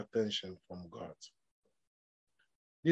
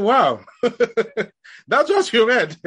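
high, louder wow breaking into laughter; amused disbelief; 6 semitones higher, 5 dB louder, much slower than usual. Retold or imitated. Father reacting to his child's recited verse, laughing in disbelief. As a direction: laugh through the words, slowly.

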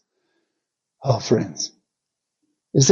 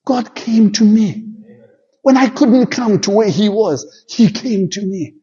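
high, ringing preacher's cry; fervent preaching; 10 semitones higher than usual. Acted. Preacher proclaiming God came to him, ringing and high. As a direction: preach it out to the congregation at full force.

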